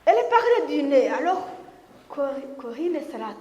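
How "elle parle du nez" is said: talking through the nose, fast, laughing; nasal voice. Shown as a demonstration. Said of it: "J'essaye, hein?". (voix nasillarde, en riant)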